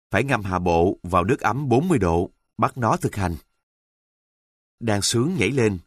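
usual speaking voice

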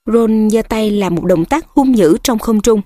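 ordinary delivery

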